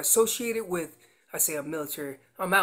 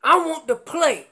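a mock bull's call, louder and higher; excited bull's yell, louder and higher; 4 semitones higher, 8 dB louder than usual. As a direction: call out like a rutting bull looking for a fight. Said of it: "Remember, I'm yelling".

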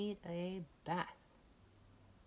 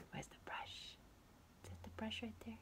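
hushed; 5 semitones higher, 9 dB quieter than usual. Real, not acted. Playful hushed search.

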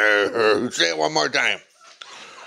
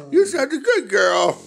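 much higher pet voice; 12 semitones higher than usual. Real, not acted. Owner praising her dog in high baby talk.

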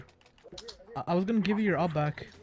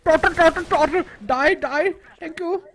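excited; 12 semitones higher, 8 dB louder than usual. Real, not acted. Hyped during a kill streak.

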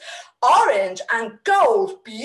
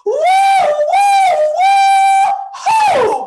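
very high, loud held woos; loud whooping cheer; 8 dB louder, slower than usual. Pretend. Host cheering the hero, high whoops. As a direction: whoop it out like a song's big finish.

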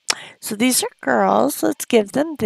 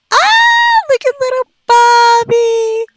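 much higher, louder, delighted coo; 12 semitones higher, 5 dB louder than usual. Acted. Cooing at her toddler Sim Bobby, delighted and high. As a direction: gush over the little one.

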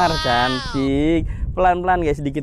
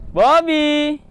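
calling out; 10 semitones higher, 3 dB louder than usual. Real, not acted. Calling bird back.

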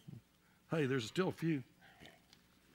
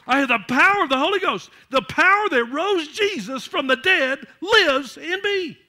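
higher, much louder shouted sermon; full-force preaching; 8 semitones higher, 10 dB louder than usual. Acted. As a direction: shout triumph over the devil.

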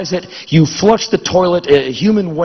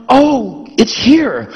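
mock delight; 7 semitones higher, much slower than usual.

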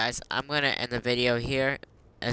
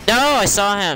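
indignant shout; 10 semitones higher, 9 dB louder than usual. Real, not acted. Player protests a death.

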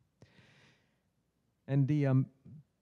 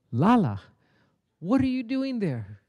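surprised saint; 7 semitones higher than usual. Acted. Saint surprised to find dog.

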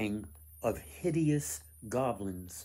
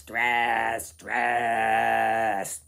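loud, low-pitched goblin bellow; a big goblin booming the word; low goblin cry; 9 dB louder than usual. Acted. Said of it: "they mumbled as they went".

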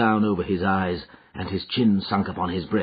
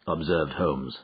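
plain reading voice

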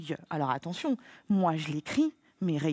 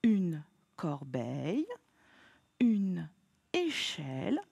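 slow reading; 2 semitones lower, slower than usual. Shown as a demonstration. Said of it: "très bien".

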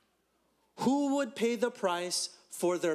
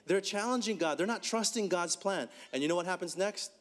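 ordinary delivery

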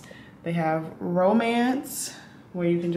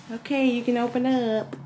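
usual speaking voice

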